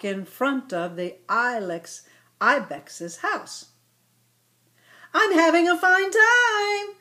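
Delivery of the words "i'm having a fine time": put-on higher, louder happy girl voice; cheerful girl calling. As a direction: call out to your friend while riding. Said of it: "Irene called to Ilex Ibex".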